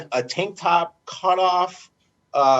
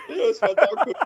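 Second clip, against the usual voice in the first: lightly mimicked teammate, higher pitch; playful higher-pitched quote; Yorkie; 8 semitones higher, faster than usual. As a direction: imitate the guy in the corner. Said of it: "Yorkie's sitting in the corner like".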